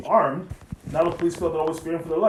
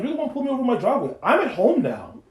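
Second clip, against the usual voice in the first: higher, exasperated retort; indignant; 9 semitones higher, 5 dB louder than usual. Retold or imitated. Driver telling cop he is home already, indignant. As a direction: argue back with rising exasperation. Retelling argument with police.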